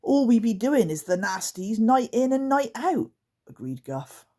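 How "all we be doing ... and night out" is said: higher, louder creature voice; half-giggling goblin grumble; creaker voice. Acted. Guff agrees with the others, higher creature voice.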